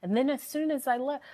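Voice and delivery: usual speaking voice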